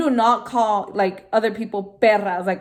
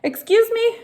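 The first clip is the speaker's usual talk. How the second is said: high, sharp, offended excuse me; a high indignant challenge; 13 semitones higher, 4 dB louder than usual. Retold or imitated. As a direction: act shocked and insulted. Indignant retort to neighbor.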